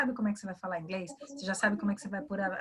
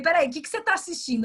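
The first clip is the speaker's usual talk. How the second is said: alarmed wait; 6 semitones higher, 4 dB louder, faster than usual. Retold or imitated. Mother's alarmed question quoted.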